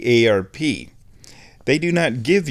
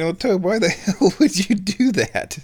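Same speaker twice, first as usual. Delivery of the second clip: higher-pitched imagined objector; incredulous listener; 9 semitones higher than usual. Acted. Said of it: "You're thinking".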